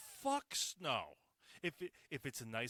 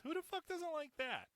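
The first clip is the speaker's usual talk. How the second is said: excited. Real, not acted. Enthusing about snowball fights.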